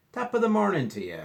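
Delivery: high, loud hearty hello; jolly Irish greeting. Acted. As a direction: greet them like a cheerful old Irishman. Mister Kelly greets the class.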